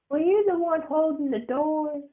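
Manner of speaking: put-on high suspicious kid voice; a child putting two and two together; high boyish question; curious little boy.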